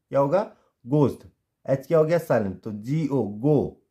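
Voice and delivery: ordinary talk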